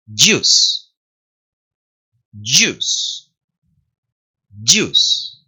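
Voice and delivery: "Juice" firmly said, buzzy at the start; English J drill. Shown as a demonstration. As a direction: model each "Juice" separately as dyoos. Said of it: "la D medio solapadita y la Y más fuerte".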